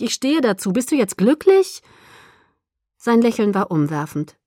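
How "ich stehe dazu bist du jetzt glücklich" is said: exasperated, raised pitch; high-pitched, grudging concession; groaning. A narrator's reading. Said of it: "stöhnte ich".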